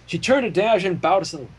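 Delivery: ordinary delivery, nothing marked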